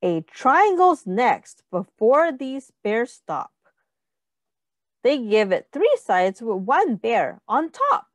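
plain reading voice

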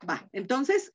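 usual speaking voice

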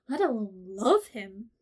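ordinary delivery

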